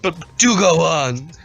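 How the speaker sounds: hammed-up psychic; fake psychic. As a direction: play the showy medium. Fake psychic answers the mother.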